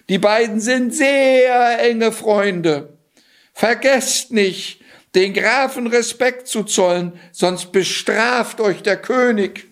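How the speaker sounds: high, slow, urgent cat voice; cat warning villagers. Acted. The cat warning the villagers to respect the count, high and slow. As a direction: press the warning home, slowly and high. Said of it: "rief er ihnen zu".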